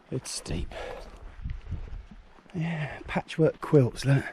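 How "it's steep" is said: "It's steep" breathed unvoiced, low and soft; whispered. Shown as a demonstration.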